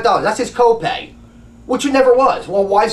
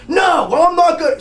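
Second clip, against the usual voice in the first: screaming doctor; 11 semitones higher than usual. Retold or imitated. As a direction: shout him down like the angry doctor.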